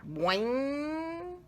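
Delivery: high drawn-out boing; a cartoon spring sound; springy boing. A narrator's reading. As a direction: make the hair spring up. Hair springing back.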